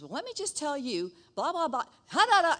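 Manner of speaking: hammed-up rude woman building loud; mock rude woman. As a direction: ham up her blah blah tantrum. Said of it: "And she goes".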